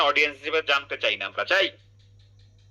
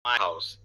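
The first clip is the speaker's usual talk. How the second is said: "My house" low, both words weighted; stress on meaning words; 9 semitones lower, 4 dB quieter than usual. Shown as a demonstration. Teacher shows students which words carry meaning, stressed. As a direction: give "My" and "house" each full weight, low. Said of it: "যেখানে meaning দেবে সেখানেই জোর পড়বে".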